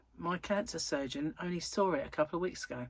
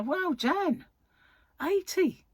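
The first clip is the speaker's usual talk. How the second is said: mock amazement; 11 semitones higher, 4 dB louder, much slower than usual. Reacting to friend's eightieth.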